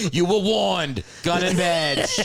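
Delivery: high, loud hammed-up Boston accent, "gun and badge" rising; mock Boston cop accent. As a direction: play a Boston movie cop demanding the badge. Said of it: "Sounds like Boston".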